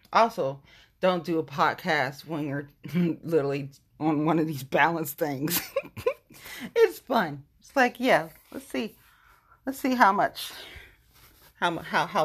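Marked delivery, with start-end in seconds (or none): 5.7-6.1 laugh. Real, not acted. Laughing on balance board.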